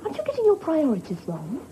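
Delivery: normal talking voice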